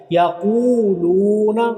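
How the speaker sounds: slow chanted recitation, हा held long; Quran recitation. Shown as a demonstration. Teacher reciting Quran for students, slow drawn-out chant. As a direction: recite slowly, stretch the हा. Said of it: "दोनों को आपने लंबा कर लेंगे".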